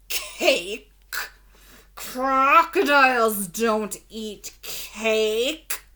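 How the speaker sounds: slow, loud and fierce; loud fierce crocodile. A narrator's reading. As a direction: growl it out like an angry crocodile. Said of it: "In a loud and fierce voice".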